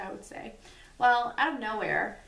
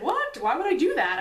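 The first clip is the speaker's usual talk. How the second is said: high, louder disbelieving what; baffled; 7 semitones higher, 7 dB louder than usual. Retold or imitated. Husband hearing what he said in his sleep, baffled. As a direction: squeak out total disbelief.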